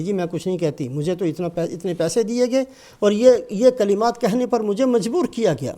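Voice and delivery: ordinary delivery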